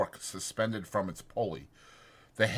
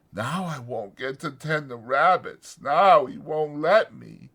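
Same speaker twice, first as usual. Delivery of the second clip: plain reading voice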